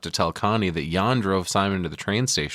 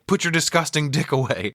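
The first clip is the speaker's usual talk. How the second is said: mock angry yell; 8 semitones higher than usual. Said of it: "he's screaming in Swedish".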